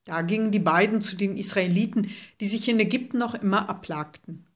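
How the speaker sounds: plain reading voice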